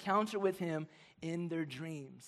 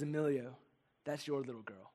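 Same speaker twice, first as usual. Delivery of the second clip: softer, hushed voice from the dark; soft, hushed dream voice; a divine voice; 4 semitones lower, 9 dB quieter than usual. Retold or imitated. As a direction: speak it like a quiet voice in a dream. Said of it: "I just hear this voice".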